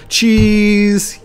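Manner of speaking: high, loud, drawn-out photo call; photo "cheese". Pretend. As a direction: sing out for the group photo. Posing for group photo.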